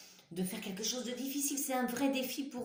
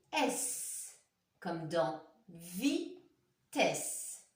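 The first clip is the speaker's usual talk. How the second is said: S and comme dans vitesse said very slowly; slow teaching voice; 2 semitones lower, much slower than usual. Shown as a demonstration. Teacher to pupils, spelling the ess sound slowly. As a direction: draw each word out so the class follows.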